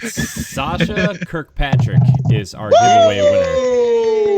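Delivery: high, drawn-out announcer shout; big winner announcement. Real, not acted. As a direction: announce the winner like a game-show host, loud and slow. Winner announced after drum roll.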